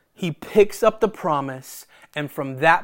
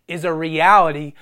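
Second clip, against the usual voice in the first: ordinary delivery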